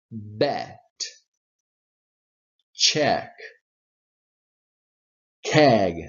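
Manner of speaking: Bet, Check, Keg as isolated words with clear eh; repeat-after-me drill. Shown as a demonstration. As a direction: model each word, pausing for repetition. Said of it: "You can repeat".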